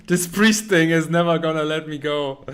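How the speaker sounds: loud, fed-up laughing cry; exasperated shout. Real, not acted. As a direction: shout it, amused but exasperated. Laughing at chat's priest joke.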